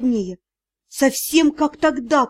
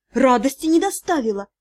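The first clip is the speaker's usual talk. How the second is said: plain reading voice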